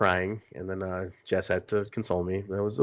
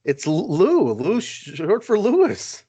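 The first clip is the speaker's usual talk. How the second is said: mock indignant; 8 semitones higher, 5 dB louder, slower than usual. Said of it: "you don't do that".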